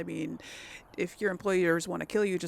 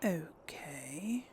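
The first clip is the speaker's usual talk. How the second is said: soft whispered okay with a rise; 5 semitones lower, 8 dB quieter than usual. Real, not acted. Streamer mulling over a dialogue option, whispered. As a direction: breathe the word, no voice. Whispering while reading choices.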